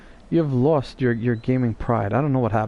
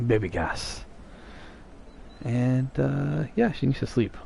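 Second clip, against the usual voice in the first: hushed; 6 dB quieter than usual. Real, not acted. Baby sleeping nearby.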